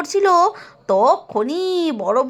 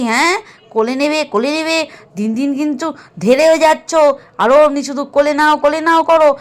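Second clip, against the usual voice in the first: louder, fast nagging granny; exasperated grandmother, raised voice; loud scolding grandmother; 5 dB louder, faster than usual. Acted. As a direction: scold the grandchild and call the daughters-in-law. Grandmother scolding child, calling.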